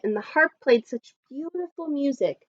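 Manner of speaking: plain reading voice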